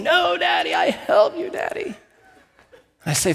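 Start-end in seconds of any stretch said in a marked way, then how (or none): 0.0-1.9 put-on toddler screaming. Toddler refusing to leave the doorway, high mock wail. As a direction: imitate a small boy shrieking to help. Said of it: "he starts screaming".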